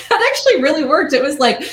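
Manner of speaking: bright laughing delight, quick. Real, not acted. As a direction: laugh through the words. Laughing at a good take.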